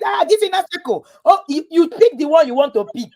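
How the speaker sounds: high-pitched acted complaint; mock grumbling. Spouse complaining about Inner Circle, mock exasperation.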